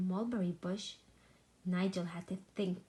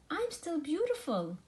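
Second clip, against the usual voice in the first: higher, happy little cheer; a sheep delighted after shearing; 8 semitones higher than usual. A narrator's reading. Shorn sheep happily cheering about himself. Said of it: "Nigel cheered".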